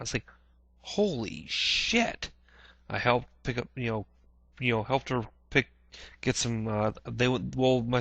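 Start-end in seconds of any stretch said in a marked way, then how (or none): 0.8-2.3 higher, slow, drawn-out gasp of shock; stunned disbelief. Retold or imitated. Hearing from mom about the Pentagon, slow stunned shock. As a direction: let the horror sink in as you say it.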